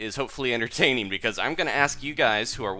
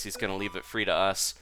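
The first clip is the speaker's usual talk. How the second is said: ordinary talk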